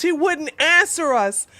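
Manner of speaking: usual speaking voice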